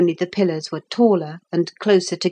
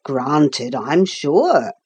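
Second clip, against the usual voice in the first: lower, easygoing workman's voice; 4 semitones lower, much slower than usual. Acted. Workman accepting a boy's apology, easygoing. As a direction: play a genial old carpenter, pitched down. Carpenter figure answers the boy.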